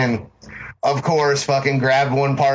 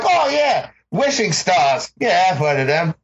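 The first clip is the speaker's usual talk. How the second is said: lightly voiced talking monkey, pitched up; a higher-pitched, mock-casual monkey voice; 7 semitones higher than usual. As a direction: play an unimpressed talking monkey. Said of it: "if you're a monkey that can talk".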